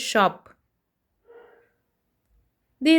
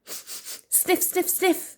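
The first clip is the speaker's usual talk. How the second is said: breathy sniffs through the nose; sniffing sounds; 6 semitones higher, 5 dB quieter, much slower than usual. Acted. Storyteller sniffing for Nell on the trail.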